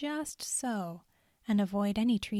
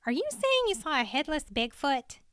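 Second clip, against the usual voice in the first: higher voice, doubtful question; a hint of disbelief in a higher voice; lightly voiced incredulous woman; 6 semitones higher than usual. A narrator's reading. Cheryl questioning Andy's story, lightly voiced and doubtful.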